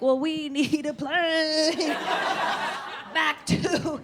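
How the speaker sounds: said slowly as if to a foreigner; slow, overclear tourist talk; slow overenunciated. Retold or imitated. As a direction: spell it out slowly for the French. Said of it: "We're like".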